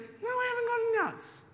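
high, exasperated teacher. Acted. Teacher refuses squirrel.

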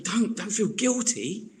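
rally the furloughed friend to pray; urgent encouragement. Retold or imitated. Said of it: "And I was like".

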